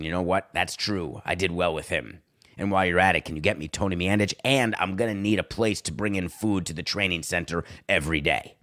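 low, understated put-on phone voice; Aaron Rodgers listing demands. Aaron dictating his player list to Woody, in a low voice. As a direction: keep it low and matter-of-fact, imitating a presumptuous caller. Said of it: "I bet he talks like this".

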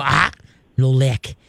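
a mild growl on Ah, then a firm no lick. Shown as a demonstration. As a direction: growl it low at the dog. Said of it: "give a deep growl and go".

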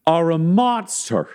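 high, drawn-out accusing yell. Acted. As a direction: keep hurling it at him. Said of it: "as she yells".

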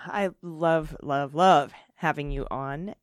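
ordinary talk

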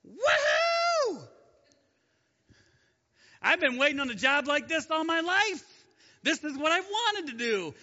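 high, loud mock-eager cheer; excited whoop. Imagined Moses cheering at God's call, high and loud. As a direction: whoop it up like the dream job just landed.

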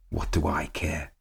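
soft and dismissive, a touch cruel. A narrator's reading. Man coldly dismissing where she goes, cruel. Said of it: "he answered cruelly".

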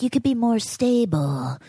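condescending doctor. Retold or imitated. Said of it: "they like".

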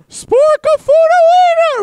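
squealed high and slow; silly high voice. Retold or imitated. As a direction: stretch the name out in a squeaky voice. Wild voice for silly name.

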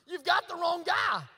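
slow, high shout; shouted protest. Retold or imitated. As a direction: shout the objection, drawn out. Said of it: "what he says is".

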